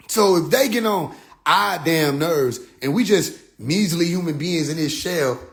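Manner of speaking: ordinary talk, nothing marked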